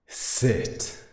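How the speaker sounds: angry repeat of an ignored command; sharp command. Acted.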